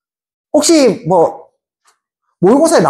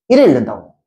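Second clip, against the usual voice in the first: ordinary talk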